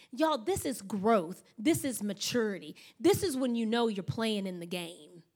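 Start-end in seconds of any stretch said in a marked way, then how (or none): none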